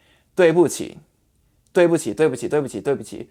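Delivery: 对不起 over and over, speeding up; fast repetition. Shown as a demonstration. Teacher drills the phrase, speeding up. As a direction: rattle off 对不起 quickly. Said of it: "try saying really fast".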